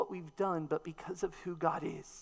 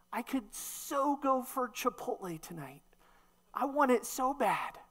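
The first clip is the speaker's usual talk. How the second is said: higher, gushing stress on so; mock gushing craving; 6 semitones higher than usual. Preacher mimicking modern slang, higher and gushing. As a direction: play a dramatic foodie craving. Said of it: "a statement of degree".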